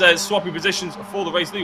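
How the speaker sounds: ordinary delivery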